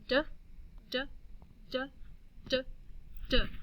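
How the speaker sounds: D as a short "duh", five times; short, evenly spaced "D" sounds, duh; letter sound. Shown as a demonstration. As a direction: drill the sound of "D" in separate beats. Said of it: "making the sounds".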